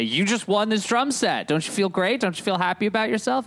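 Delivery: hammed-up fans announcing a prize; gushing fan crowd. Host mimics fans handing him another drum set, eager and high.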